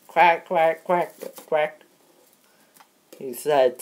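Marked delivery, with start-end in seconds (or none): 0.1-1.8 high, loud mock quacking; duck quack. Mister Duckface quacking back at the host, hammed-up.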